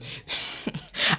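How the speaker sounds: short, voiceless snicker; real snicker. Real, not acted. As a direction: break off into a suppressed laugh. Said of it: "I don't know why I'm laughing".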